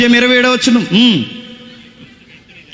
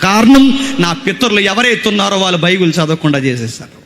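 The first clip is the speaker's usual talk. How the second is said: usual speaking voice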